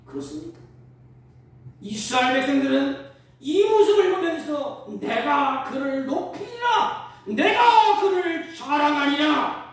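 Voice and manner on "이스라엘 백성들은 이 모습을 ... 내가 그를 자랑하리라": loud, high, slow proclamation; triumphant pulpit cry; exultant preaching. Acted. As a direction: stretch the vow out at full voice. Preaches at full force.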